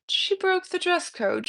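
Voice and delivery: ordinary talk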